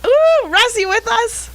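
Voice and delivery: high-pitched, sing-out call to a caller; excited check if someone's on the line. Real, not acted. Calling out to a caller.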